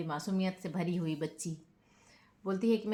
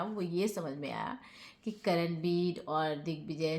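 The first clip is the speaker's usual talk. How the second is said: ordinary delivery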